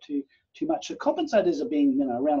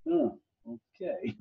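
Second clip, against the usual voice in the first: deflated oh okay; 10 semitones higher, 5 dB quieter, much slower than usual. Retold or imitated. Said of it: "I'm thinking".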